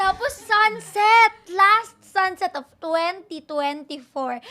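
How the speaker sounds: loud, high, drawn-out mock-cinematic narration; dramatic scene-setting. Former child star staging her cliff sunset for friends, hammed-up drama. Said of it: "gawing dramatic talaga yung".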